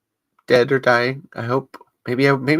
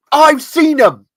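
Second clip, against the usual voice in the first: much higher, pushed accusing detective rant; Lightman impression; 9 semitones higher, 7 dB louder, slower than usual. Host closing the podcast as Lightman grilling the room, high and hammed. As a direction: grill the room like a face-reading interrogator. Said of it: "I'm gonna do a Lightman impression".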